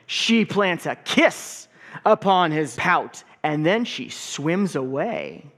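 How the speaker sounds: put-on lively picture-book read-aloud for kids; story time reading. A narrator's reading. Pastor reading a picture book to the congregation, lively read-aloud.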